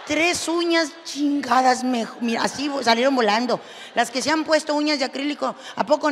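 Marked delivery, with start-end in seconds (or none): none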